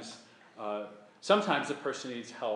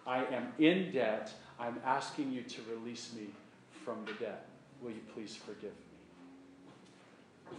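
fading to a near hush, slowing; humble plea; 3 semitones lower, 7 dB quieter than usual. Shown as a demonstration. Penitent asking the wronged one for release, hushed. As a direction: let it sink to a humble hush.

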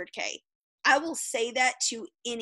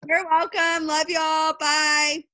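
calling out goodbye louder; loud cheery goodbye; 5 semitones higher, 7 dB louder, slower than usual. Real, not acted. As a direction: shout the bye to everyone.

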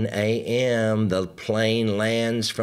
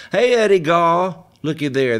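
loud, high greeting; hey there, raised and bright; calling out; 6 semitones higher, 7 dB louder than usual. Real, not acted. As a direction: hail a friend across the room. Calling out to a viewer.

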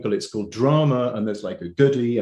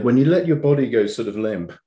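normal talking voice

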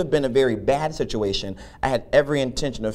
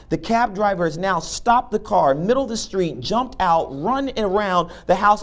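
ordinary talk, nothing marked

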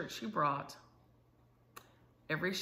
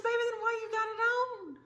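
high, sing-song coaxing voice to a little one; high gentle coaxing for a crying granddaughter. Retold or imitated. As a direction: coax her gently, pitched way up. Grandma voicing herself to child.

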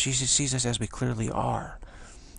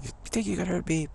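high-pitched, scoffing challenge; mock laughing taunt; 9 semitones higher than usual. Batter to the pitcher who hit him, laughing defiance.

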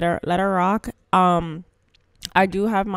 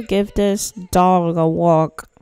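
usual speaking voice

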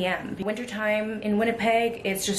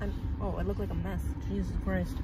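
sad; 7 dB quieter than usual. Real, not acted. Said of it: "I'm so sad".

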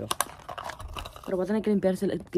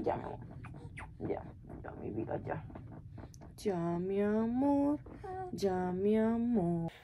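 soothing hush; 4 semitones higher, 9 dB quieter, slower than usual. Real, not acted. Mother hushes her crying baby softly and slowly.

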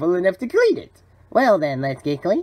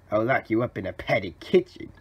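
ordinary delivery

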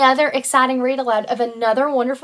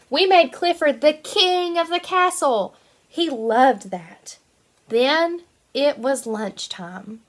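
bright, lively storybook reading; reading to children; 2 semitones higher, slower than usual. Acted. Teacher reading a picture book to her kindergarten class, lively storytelling. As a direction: read it out like it's circle time.

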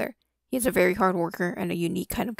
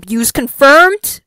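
loud; 6 semitones higher, 14 dB louder, slower than usual. Real, not acted. Mock outrage at the clip.

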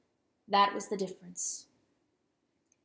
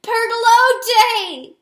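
very loud, high, drawn-out cry; pained wail; 13 semitones higher, 14 dB louder, much slower than usual. A narrator's reading. Rooster calling after the fleeing hen, pained wail. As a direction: wail the name slowly at full voice. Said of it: "he wailed in pain".

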